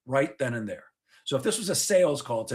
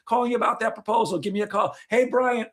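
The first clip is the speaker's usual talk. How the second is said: chirpy voicemail; 7 semitones higher than usual. Retold or imitated. A salesman leaving chasing voicemails for Brian, mock chirpy and high. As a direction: play the hopeful rep leaving cheery voicemails. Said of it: "we leave messages like this".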